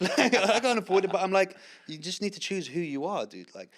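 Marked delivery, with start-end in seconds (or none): 0.3-1.6 high, loud, laughing admission. Real, not acted. Guest admits he can't afford it either, laughing. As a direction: repeat it through a laugh.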